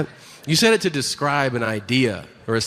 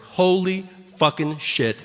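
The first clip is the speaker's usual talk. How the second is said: slow, higher, stunned amazement; mock incredulous; 4 semitones higher, slower than usual. People finding out he speaks Spanish, mock incredulity. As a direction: draw it out in mock disbelief. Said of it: "completely incredulous people who are like".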